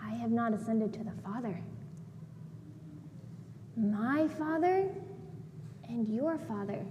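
low, soft, measured reply; hushed and low, with reverent pauses; Jesus speaking gently. Retold or imitated. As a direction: voice a quiet, solemn teacher addressing a follower. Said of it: "And he says".

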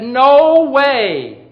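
high, loud, drawn-out "no way"; frightened denial. Retold or imitated. Peter denying Jesus to a little girl, frightened denial. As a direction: blurt out an emphatic mock denial. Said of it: "And he said".

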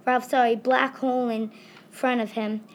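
ordinary delivery

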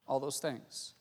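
ordinary talk

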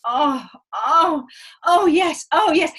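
loud hammed-up moans of pleasure; mock moaning. Patient pretending to enjoy the exam, hammed-up loud moans. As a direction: fake pleasure to mock the doctor. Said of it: "I should have started going".

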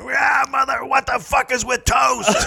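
high, yelling stand-up rant; screaming comic rant. Pretend. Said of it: "the screaming guy up there, rant".

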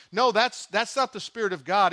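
normal talking voice

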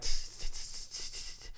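soft unvoiced tss tss, imitating the mirror on grass; mirror scraping grass. Friend imitates his mirror dragging through grass, soft tss. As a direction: hiss out a scraping noise. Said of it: "I'm hearing shit like".

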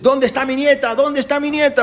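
high, loud, desperate calling for his granddaughter; an old man yelling for the girl; retold shout. Old grandfather shouting in the hospital for his granddaughter, loud and high. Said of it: "Empezaron a gritar".

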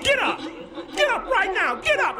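very high frantic yell, imitating father; imitated father's high, crazed cry; crazed shouting. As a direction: shout them awake in wild panic. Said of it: "he's shouting crazy".

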